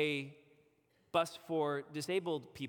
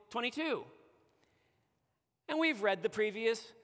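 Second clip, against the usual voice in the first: higher, pressing indignant challenge; incensed rebuke; 6 semitones higher than usual. Pretend. Congregation rebuking David for claiming to be blameless, incensed. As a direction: confront David as if he lied to your face. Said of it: "we would be rightly incensed".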